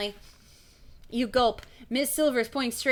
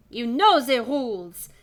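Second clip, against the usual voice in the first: slow, loud French quizzing; slow French-accented teacher's question; 2 semitones higher, 6 dB louder, slower than usual. Acted. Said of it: "She's French as well".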